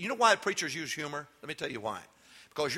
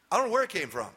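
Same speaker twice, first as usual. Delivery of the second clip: ordinary talk, nothing marked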